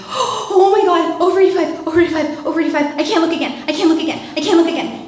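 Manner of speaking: high, loud, repetitive freak-out; terrified panic. Retold or imitated. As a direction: report the speed in mounting hysteria. Terrified at the speedometer.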